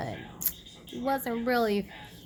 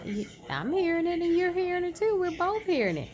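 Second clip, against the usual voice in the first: much higher, bubbling with excitement; excited nurse; 9 semitones higher, faster than usual. Retold or imitated. Nurse telling the patient she hears it, excited and high. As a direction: imitate the thrilled nurse.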